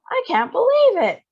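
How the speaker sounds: high, loud amazed yell. A narrator's reading. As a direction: yell in disbelief. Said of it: "Grandpa Joe was yelling".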